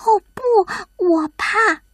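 high, timid cry of fear; alarmed little creature, pitched high; frightened. A narrator's reading. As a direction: recoil in a small scared hedgehog voice. Hedgehog frightened by mention of storm.

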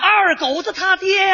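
high, very stretched-out call. Acted. Soldier claiming kinship across the lines, calling out. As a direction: holler it with long drawn vowels.

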